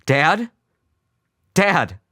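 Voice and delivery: high, loud urgent call. A narrator's reading. Son calling his unresponsive father, urgent. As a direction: call out sharply for his attention.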